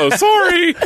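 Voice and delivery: high giggly sorry; laughing apology. Retold or imitated. Apologizing to audiobook producer.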